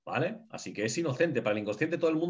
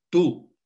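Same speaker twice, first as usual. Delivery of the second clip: a loud, higher punch on Tú; emphatic Tú; 5 semitones higher, 8 dB louder than usual. Real, not acted. Teacher drives home his point, loud emphatic Tú. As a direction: hammer it home: Tú. Insisting the other is you.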